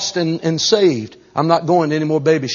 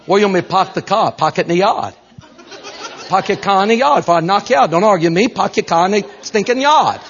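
higher, pushy mock-Bostonian accent; 6 semitones higher than usual. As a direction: argue like a tough Boston local. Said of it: "Bostonian from Boston".

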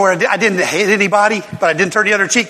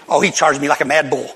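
usual speaking voice

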